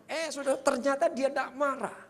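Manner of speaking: ordinary delivery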